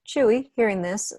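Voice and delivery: usual speaking voice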